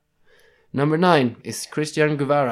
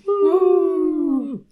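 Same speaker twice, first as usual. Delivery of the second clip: high whooping cheer; 6 dB louder than usual. Real, not acted. Host cheers the Sword and Buckler list, excited. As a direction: whoop for the winners. Cheering after the last name.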